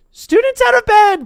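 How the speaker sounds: high, loud alarm-raising yell; bellowed. Acted. Peeves bellowing to wake the castle. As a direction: shout it down the halls. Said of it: "Peeves bellowed".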